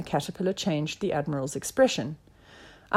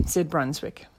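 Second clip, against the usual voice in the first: ordinary delivery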